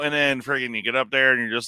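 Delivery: normal talking voice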